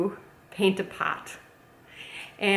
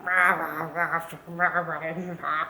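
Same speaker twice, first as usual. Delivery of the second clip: low, garbled mock rant; 3 semitones lower, slower than usual. Mocking people who swear needlessly, low blurred babble. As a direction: imitate mindless swearing as a low growly babble. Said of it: "when people are just like".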